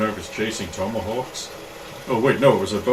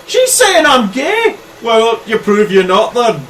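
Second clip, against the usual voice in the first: loud, high-pitched indignation; offended challenge; 13 semitones higher, 11 dB louder, slower than usual. Acted. Local girl taking offence at stranger, loud high challenge. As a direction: rear up, louder and higher.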